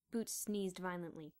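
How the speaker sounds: ordinary delivery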